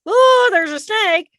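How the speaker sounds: high, loud, drawn-out alarmed gasp; startled fear. Acted. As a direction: gasp it out slowly in fright. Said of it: "I start feeling".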